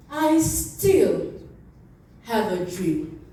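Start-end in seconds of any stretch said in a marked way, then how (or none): none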